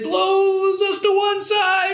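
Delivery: high, singsong swoop; playful high-pitched glide; blown by wind. Pretend. Acts wind pushing the trees over.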